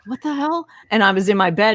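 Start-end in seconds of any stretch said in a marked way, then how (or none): 0.1-0.7 higher, baffled exclamation; retold bewilderment. Teen waking cold in bed, bewildered. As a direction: wake up confused, voice pitched up. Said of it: "and I'm like".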